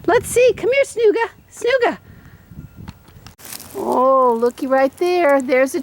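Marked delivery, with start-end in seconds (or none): 0.0-2.0 very high, sing-song cheering for the dog; excited dog talk. Real, not acted. Owner urging her dog to hunt Easter treats, very high excited voice. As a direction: egg the dog on in a high, happy voice.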